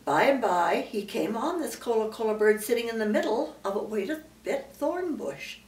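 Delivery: ordinary delivery, nothing marked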